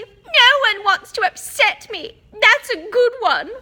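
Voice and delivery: high, loud bitter howl. A narrator's reading. Ghost girl howls back at Hermione, bitter and loud. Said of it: "howled Myrtle".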